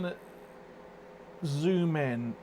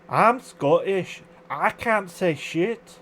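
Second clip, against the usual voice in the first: put-on slow, loud Scottish accent; 4 semitones higher, 4 dB louder, slower than usual. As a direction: drag it out in a broad Scottish accent. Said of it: "best Scottish accent I've ever done".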